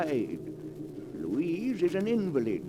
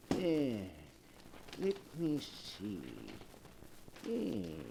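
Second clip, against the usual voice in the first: murmuring to himself; 4 semitones lower, 12 dB quieter, slower than usual. Acted. Host searches the book, murmuring.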